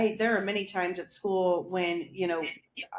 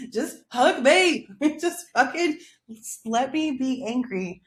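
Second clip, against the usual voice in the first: loud, high, mock-desperate demand; hammed-up outburst begging for comfort; angry plea; 5 semitones higher, 5 dB louder than usual. Upset person demanding a hug while angry, pleading.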